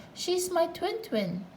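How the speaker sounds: high, slow playful explanation; twin voice. A narrator's reading. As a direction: say it as a sing-song twin.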